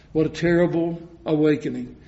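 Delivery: ordinary talk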